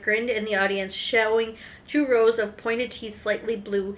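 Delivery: ordinary delivery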